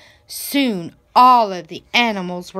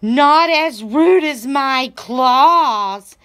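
slow, louder, menacing warning; a slow threat from a cat; 5 semitones higher, 6 dB louder, slower than usual. A narrator's reading. Cat warns Goat about its claws, slow threat. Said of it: "warned Cat".

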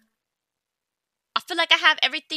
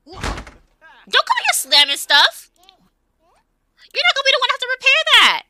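loud, higher scolding, slow at first; angry scolding; 9 semitones higher, 7 dB louder than usual. Real, not acted. Gamer scolding a Sim for slamming things, angry scolding. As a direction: tell the Sim off hard.

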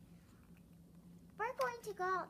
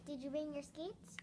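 normal talking voice

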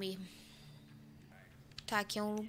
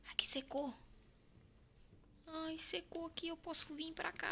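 tense whisper; 6 semitones higher, 7 dB quieter than usual. Real, not acted. Sneaking in horror game.

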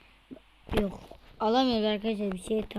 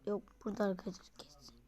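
rapid hushed whisper, much softer; fast whisper; 10 dB quieter, much faster than usual. Real, not acted. Gamer whispering to viewers while hunting an enemy in a bush. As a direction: whisper quickly, sneaking up.

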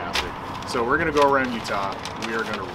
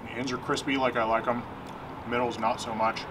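chewing while talking; words muffled by food; mouth full of fries; 6 dB quieter than usual. Real, not acted. Seen 3: Fry eaten at -2; chewing mouth movements visible until about 4.3s.

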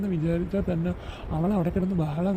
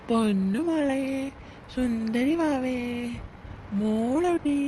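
put-on high, slow cooing to a baby; father sweet-talking his baby girl, high cooing; 5 semitones higher, much slower than usual. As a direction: coo sweetly to the little one.

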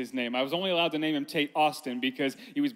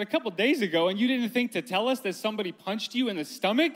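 raised-pitch retold parental disbelief; incredulous echo; 5 semitones higher, 3 dB louder than usual. Dad retelling his reply to his son, incredulous. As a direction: throw it back as a louder, higher astonished question.

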